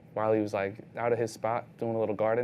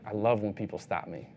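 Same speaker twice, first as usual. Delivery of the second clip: usual speaking voice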